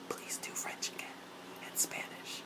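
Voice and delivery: barely audible, voiceless whisper; whispered plea. Real, not acted. Fan begging to hear Magnus speak languages, whispering. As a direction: beg for it in a tiny whisper.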